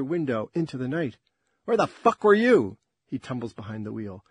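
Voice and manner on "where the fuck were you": loud, high angry outburst. Acted. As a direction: hurl it at him furiously. Jill confronting him angrily.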